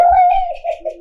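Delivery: bright high burst of laughter. Real, not acted. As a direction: laugh out loud in surprise. Laughing at mud answer.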